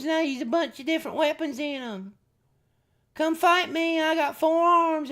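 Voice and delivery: high, goofy bug-man voice talking to viewers; silly Thri-kreen voice. Pretend. Streamer voicing a toy figure to chat, high and goofy. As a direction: play the four-armed insect as a dopey hype guy.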